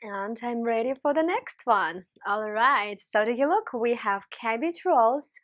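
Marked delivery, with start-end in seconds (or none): none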